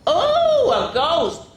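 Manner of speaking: very high loud gasp; hammed-up surprise; mock surprise. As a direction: act startled by a ghost. Surprised at ghost.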